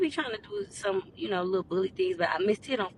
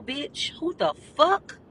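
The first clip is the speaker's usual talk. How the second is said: outraged disbelief; 8 semitones higher, 5 dB louder, much slower than usual. Retold or imitated. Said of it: "reacting to the girl".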